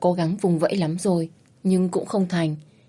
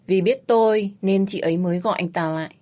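plain reading voice

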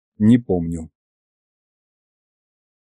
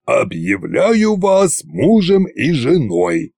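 slow, drawn-out ceremonial roar; barked proclamation; 3 semitones higher, slower than usual. A narrator's reading. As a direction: proclaim it ponderously, like a giant tsar. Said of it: "рявкнул Кощей". (протяжно и торжественно рявкнуть)

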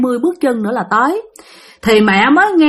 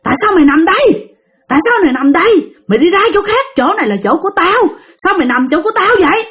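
raised-pitch scary ghost voice, mildly growled; menacing growl; 7 semitones higher, faster than usual. Acted. Unseen man orders father off his spot, lightly menacing high growl. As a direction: demand it like a territorial spirit, pitched up. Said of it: "mà nói gầm gầm cái giọng nghe thấy ghê lắm".

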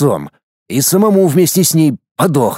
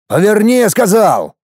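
angry command; 6 semitones higher than usual. Acted. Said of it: "приказал я водителю".

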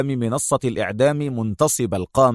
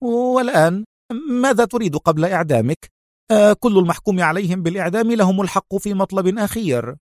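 higher, quick, nervous villainess; tense question; 8 semitones higher than usual. A narrator's reading. Sonia asks Adham his last wish, tense. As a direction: rush the last-wish offer. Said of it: "سألته في توتر".

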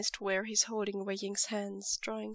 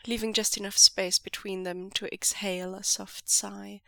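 plain reading voice

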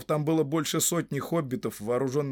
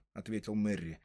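plain reading voice

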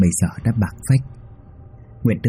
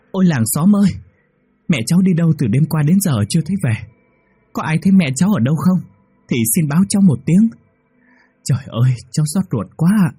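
fake wailing shout; 9 semitones higher than usual. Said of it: "gân cổ hét lên thật lớn". (giả vờ gào khóc gọi làng xóm)

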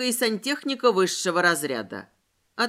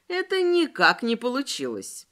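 ordinary delivery

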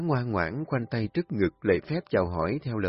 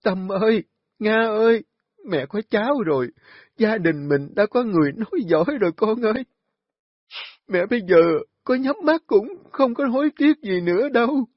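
tearful joy, high and loud; overjoyed; 11 semitones higher, 5 dB louder than usual. Acted. Grandmother calling son and daughter with tearful joy. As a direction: cry out with joy to the whole family.

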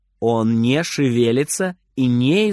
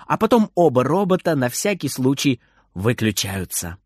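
usual speaking voice

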